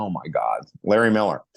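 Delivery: low, rueful mock groan; reluctant low-voiced concession; grudging groan. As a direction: drop the voice, caught out and admitting it. Grudging realization about old coach.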